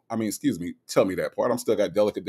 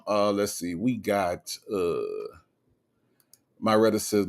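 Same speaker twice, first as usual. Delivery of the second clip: ordinary talk, nothing marked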